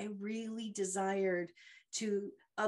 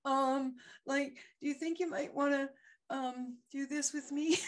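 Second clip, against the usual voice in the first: higher, hesitant and unsure, laughing at herself; mock-nervous higher voice breaking into a laugh; nervous mumbled ask; 5 semitones higher than usual. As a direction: play the terrified new coach fumbling the question. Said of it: "I did not say it that way".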